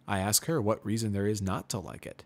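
ordinary delivery, nothing marked

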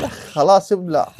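loud, slow gloating shout; drawn-out triumphant yell. Real, not acted. Gamer gloating over a killed zombie, drawn out. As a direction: stretch it out as you finish him. Finishing a mob.

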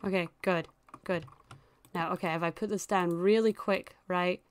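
ordinary delivery

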